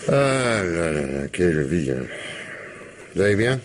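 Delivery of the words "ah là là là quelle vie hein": slow, drawn-out world-weary sigh. Acted. Commissioner sighing over his life to a colleague, wearily. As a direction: let it sink slowly into resignation.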